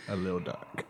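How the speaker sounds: low, soft "A little dark"; playfully sinister undertone; hushed, ominous correction. Shown as a demonstration. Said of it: "You said, you said it wrong".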